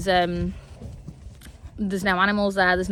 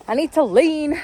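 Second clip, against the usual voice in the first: I need to lean said higher and louder; louder repeat; 6 semitones higher, 5 dB louder than usual. Shown as a demonstration. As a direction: say it again, pitched up and pushed.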